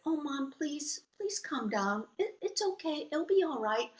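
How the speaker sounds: gently voiced child soothing an upset parent; pleading daughter. Daughter pleading with ranting mother.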